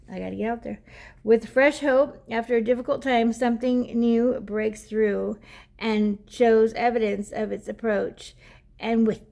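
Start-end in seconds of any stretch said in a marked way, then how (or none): 1.2-9.2 lightly lifted, slightly louder reading voice; card reading to viewers; oracle card reading. Acted. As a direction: read the guidebook aloud like a gentle message. Reads the oracle card text.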